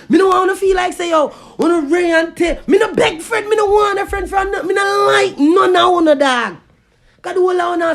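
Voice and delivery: loud, forceful defiant yelling; defiant shouting. Real, not acted. Ranting vlogger shouts defiance at his critics. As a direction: shout every line down at them.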